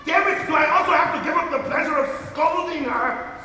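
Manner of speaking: high, fast indignant complaint; exasperated outburst. Acted. Master denied his scolding, exasperated. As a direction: burst out, high and rushed. Frustrated master interrupted by wails.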